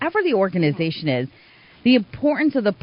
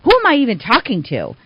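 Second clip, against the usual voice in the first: mock indignant outburst, raised pitch; retold yelling; 6 semitones higher, 3 dB louder than usual. Rink contact to the caller, mock yelling. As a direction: imitate an angry man demanding answers, short of a real yell. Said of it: "he starts yelling".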